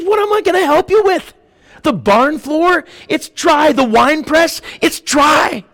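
high-pitched mock exasperation; exasperated king. Preacher as king answering the pleading woman, exasperated. Acts out king with nothing left.

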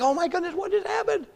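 high, astonished gasp of a crowd; amazed crowd. Retold or imitated. Crowd on shore stunned by the catch, high amazed voice. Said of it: "a huge crowd being like".

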